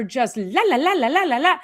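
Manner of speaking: high, loud sing-song la la la; mocking chatter. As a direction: parody a busy brain that never stops. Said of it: "what people are walking around every single day".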